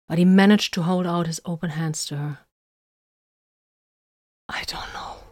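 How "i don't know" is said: breathless whisper, much softer; unsure whisper to her. A narrator's reading. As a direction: barely voice the words. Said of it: "he whispered".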